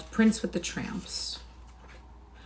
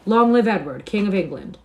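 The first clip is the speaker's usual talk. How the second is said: loud, raised rallying cry; leading a cheer; 3 semitones higher, 10 dB louder than usual. A narrator's reading. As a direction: lead the crowd in a cheer. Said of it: "Now, altogether".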